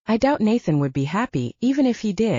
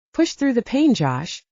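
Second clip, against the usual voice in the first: raised voice calling across the clearing; calling over; 5 semitones higher than usual. A narrator's reading. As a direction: urge him on from ten feet away. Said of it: "she called over to me".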